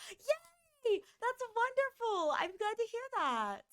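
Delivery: high happy cheer; high-pitched glad cheer; cheerful yay. Real, not acted. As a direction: cheer a friend's good news. Cheering viewer's good news.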